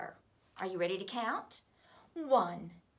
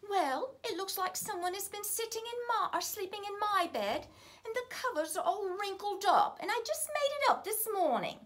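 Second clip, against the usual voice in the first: Mother Bear, much higher, fussing; medium-sized voice; 11 semitones higher, 4 dB louder than usual. A narrator's reading. Mother Bear complaining about her wrinkled bed, higher and fussy. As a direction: fret like a tidy mother bear. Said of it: "in her medium-sized voice".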